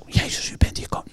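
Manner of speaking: timid unvoiced whisper; mock shy whisper. Shown as a demonstration. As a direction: say it secretly so nobody hears. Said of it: "Dus niet zo".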